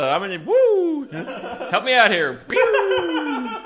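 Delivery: mock flare shot. Flare gun as cry for help.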